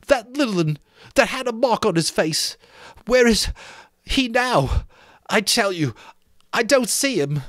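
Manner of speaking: put-on high, far-off fretful boy's voice, well above the narrator; Piggy's whiny voice. Piggy scolding the boys for rushing off, high indignant boy voice.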